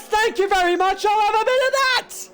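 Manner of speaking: lightly voiced cheeky driver; voiced driver quip. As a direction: toss off the line like a cheeky racer. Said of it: "And he says".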